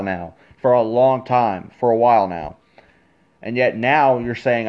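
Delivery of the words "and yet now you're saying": usual speaking voice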